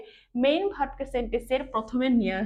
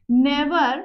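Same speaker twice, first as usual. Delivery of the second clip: Never said louder with a falling tune; stress on never; 7 dB louder than usual. Shown as a demonstration. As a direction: punch Never. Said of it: "never শব্দের উপরে জোর দিচ্ছি".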